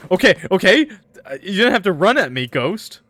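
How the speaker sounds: high burst, easing at the end; startled protest. Real, not acted. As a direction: protest loudly as if caught off guard. Reacting to ghost running at her.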